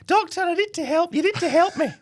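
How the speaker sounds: very high squeaky voice, a man pleading. Acted. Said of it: "This voice of mine".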